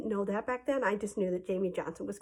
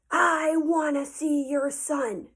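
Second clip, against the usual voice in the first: slow, loud, raised-pitch demand; scary angry dad; 6 semitones higher, 5 dB louder, much slower than usual. Retold or imitated. Bully's dad confronts the other dad, slow loud demand. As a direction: imitate an intimidating father, drawing out each word. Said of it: "scary guy".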